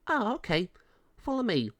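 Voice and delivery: high, quick female officer voice; friendly pound officer. Acted. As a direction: greet a visitor in a high-pitched voice.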